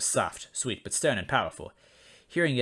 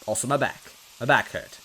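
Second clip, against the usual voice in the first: plain reading voice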